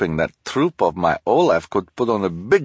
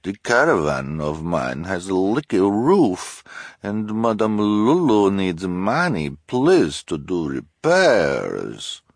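put-on high, louder, pleading foreign accent; Madame Lulu's strange accent; 6 semitones higher, 4 dB louder, slower than usual. A narrator's reading. Fortune-teller pleads poverty, in a strange accent. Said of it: "said in her strange accent".

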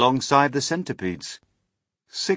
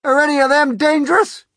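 very high, loud, anxious shout; shouted question; 12 dB louder than usual. A narrator's reading. Police chief fearing the creatures, anxious shout. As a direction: demand an answer from far below. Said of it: "shouted the chief of police".

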